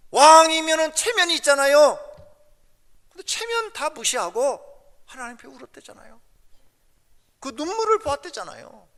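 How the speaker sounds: high, loud, insistent preacher; impassioned preaching. Real, not acted. Preacher pressing congregation that even the king wept, impassioned.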